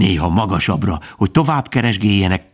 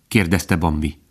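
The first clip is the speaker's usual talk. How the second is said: ordinary delivery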